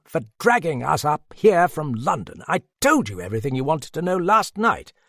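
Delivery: high-pitched aggrieved complaint; indignant protest. A narrator's reading. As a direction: protest at being dragged along. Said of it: "protested a voice behind him".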